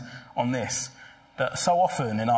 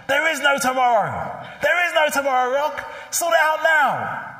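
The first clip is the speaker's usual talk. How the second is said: trainer shouting; 13 semitones higher, 6 dB louder than usual. Retold or imitated. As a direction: drive it home like a fed-up coach. Trainer confronting procrastinating boxer.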